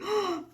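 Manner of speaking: high, quick intake of breath; surprised gasp. Real, not acted. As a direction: gasp as if struck by the likeness. Spots lookalike of her dog.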